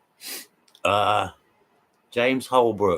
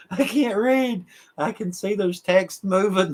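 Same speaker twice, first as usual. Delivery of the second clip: laughing; 8 semitones higher than usual. Real, not acted. Laughing fit on a livestream.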